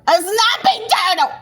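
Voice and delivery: squeaky, high-pitched comic repeat; exaggerated high exclamation; high mock cry. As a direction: repeat the punchline in a high put-on voice. Repeats punchline in a put-on voice.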